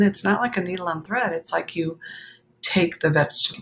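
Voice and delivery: ordinary delivery, nothing marked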